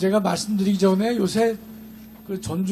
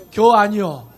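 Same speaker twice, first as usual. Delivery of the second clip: louder, clipped Chungcheong "겨 아니여"; 충청도 사투리로 툭; Chungcheong dialect; 6 dB louder than usual. Retold or imitated. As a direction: drop it punchily in Chungcheong dialect. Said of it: "충청도는".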